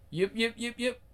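high little-dog yaps; yapping dog. Acted. The Shih Tzu yaps at the men. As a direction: yap like a tiny lapdog.

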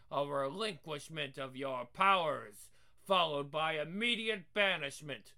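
loud commanding scold; stern angry aunt. Acted. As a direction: lay down the law harshly. Aunt punishes disobedient niece.

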